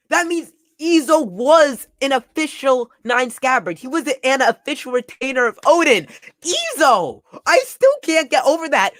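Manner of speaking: high, loud, incredulous reaction; astonished outburst. Real, not acted. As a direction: reel from a huge reveal. Reacting astonished to the Izo reveal.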